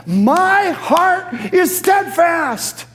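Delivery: high, slow, ringing shout; resolute proclamation. Acted. Preacher voices David's resolve from the psalm, resolute proclamation. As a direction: declare it loudly, stretching each word. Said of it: "it is his resolve".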